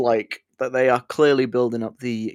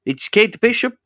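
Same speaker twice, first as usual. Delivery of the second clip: mock Russian accent; 7 semitones higher, 4 dB louder than usual. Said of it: "in that Russian accent".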